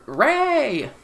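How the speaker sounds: high-pitched mock shout of a name; calling out. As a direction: call her name like across a field.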